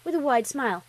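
ordinary delivery

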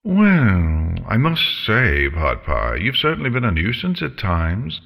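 high, lilting villainous dragon; theatrical, swooping, politely menacing dragon; idly chatting dragon. Acted. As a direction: make breezy small talk with your meal. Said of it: "idly chatting as she did so".

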